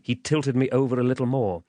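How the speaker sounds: usual speaking voice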